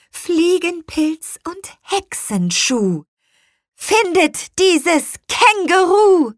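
high, slow incantation building to a shout; witch's spell. A narrator's reading. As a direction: chant the spell, end with a loud cry. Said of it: "Zauberspruch der Hexe". (hoher, langsamer Hexenspruch, zum Schrei steigend)

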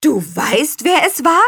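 high, loud incredulous question; amazed outcry, pitch climbing; astonished cry. A narrator's reading. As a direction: shout back in disbelief. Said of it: "riefen alle wie aus einem Mund".

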